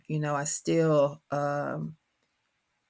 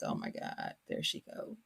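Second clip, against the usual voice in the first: low, hushed mock exasperation; imitating embarrassed kids; 12 dB quieter than usual. Kids hearing mother get twangier, muttered mock dismay. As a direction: mutter it under your breath as the kids. Kids groaning as her accent thickens.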